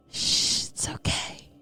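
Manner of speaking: hushed whisper, no voice at all; calm him in a breathy hush; a wife whispering comfort to her sobbing husband; soothing whisper. Acted.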